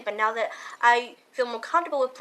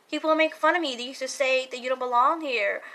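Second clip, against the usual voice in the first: ordinary talk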